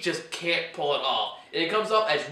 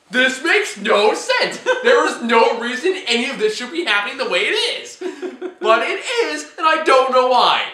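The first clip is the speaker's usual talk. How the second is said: losing my mind; 6 semitones higher, 7 dB louder than usual. Retold or imitated. Venting to a friend about a baffling movie, loud laughing rant. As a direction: throw up your hands and laugh at the nonsense. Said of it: "I started losing my mind. I was like".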